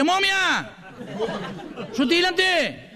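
very high yelled heckles; shouted cheers. Retold or imitated. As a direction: cry each word up at the stage. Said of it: "el negro de abajo seguía".

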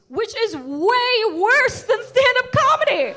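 high, loud, drawn-out protest; indignant exclamation. Real, not acted. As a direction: stretch it into a comic yell. Indignant about mother's logic.

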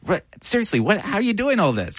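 high, amazed disbelief; can't believe how much she does. Real, not acted. Host amazed at her projects.

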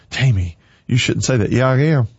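ordinary talk, nothing marked

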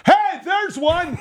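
very high excited call-out; mock hunter's shout. Moose hunter alerting his partner instead of whispering, mock shout. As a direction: yell it as if spotting game.

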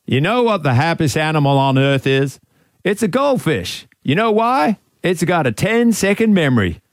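put-on American coach accent, building to a high finish; Ted Lasso accent. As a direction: deliver it as Ted Lasso, rising to the punchline. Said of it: "get into character".

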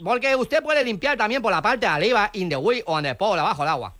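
put-on foreign accent with L for R; foreign-accented broken Spanish; mock foreign accent. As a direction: play a foreigner mangling Spanish, R turned to L. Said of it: "si no sabe hablar castellano".

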